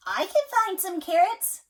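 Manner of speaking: a keen little bunny volunteering; rabbit voice. A narrator's reading. Parent reading to child, rabbit offers help. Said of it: "said the rabbit".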